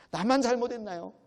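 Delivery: 나만 cried out high and loud, a protest; rough outcry. Retold or imitated. Job protesting to God, 나만 shouted. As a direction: throw 나만 out loudly, accusing. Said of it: "막 거칠게 나오는 거죠".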